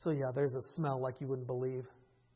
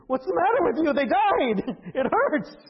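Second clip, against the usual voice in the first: laughing, higher and louder; laughing disbelief; 12 semitones higher, 10 dB louder than usual. Real, not acted. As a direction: laugh at the absurdity.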